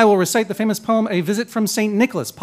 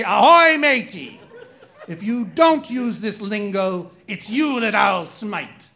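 aggressive pirate. Acted. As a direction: threaten the crew like a hammy pirate, slowed down. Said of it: "amazing and aggressive pirate".

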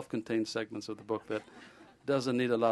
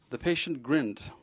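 lively read-aloud of a novel, a little higher; story reading; 4 semitones higher, 4 dB louder than usual. A narrator's reading. Author reading his novel aloud to a crowd, lively storytelling. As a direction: read your novel to the audience with relish.

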